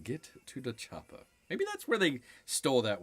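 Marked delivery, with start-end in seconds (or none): 0.0-1.2 soft muttered action hero line; half-voiced movie quote; quiet repeat of quote. Repeating the movie line to himself, quieter. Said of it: "as someone would say".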